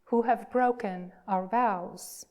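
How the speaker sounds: ordinary talk, nothing marked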